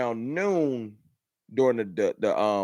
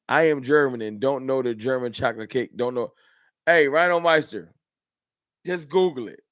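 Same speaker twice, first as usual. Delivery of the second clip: ordinary delivery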